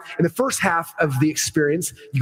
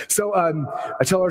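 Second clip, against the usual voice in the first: ordinary talk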